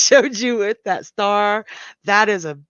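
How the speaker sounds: usual speaking voice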